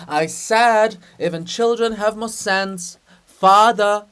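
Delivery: loud, high call across the room. Acted. Hrushevsky calling out to wake Father, loud. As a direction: shout it to wake him. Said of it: "Calling out".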